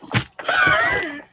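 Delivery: very high squealing laugh. Real, not acted. Seller laughing after joking viewers will buy her a desk, high squeal.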